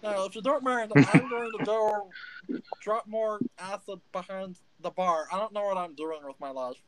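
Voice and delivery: a little gnome spiralling into a crisis; panicky gnome Stitch. Acted. Gnome Stitch freaks out that a stranger guessed his name. Stitch talks with tavern patrons.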